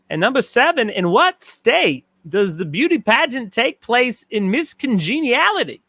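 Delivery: a playful, loosely done Walken read of a trivia question; bad Christopher Walken impression. Host reads the question as a bad Christopher Walken. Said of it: "That's my bad Christopher Walken impression".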